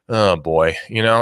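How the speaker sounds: ordinary delivery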